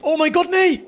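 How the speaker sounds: from worried "oh oh" building to a loud, high shout; real panic at the moment of dying; rising panic. Real, not acted. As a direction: panic climbing until it bursts out. Character dying in game.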